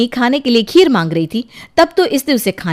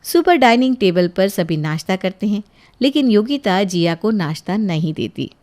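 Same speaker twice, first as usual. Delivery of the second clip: usual speaking voice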